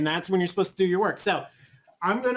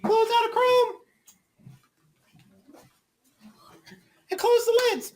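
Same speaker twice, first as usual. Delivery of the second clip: Mrs Overton voice. Pretend. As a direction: keep playing the substitute, pitched way up. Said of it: "Missus Overton mode".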